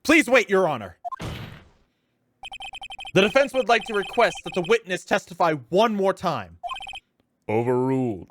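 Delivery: lightly voiced old judge; stern courtroom judge.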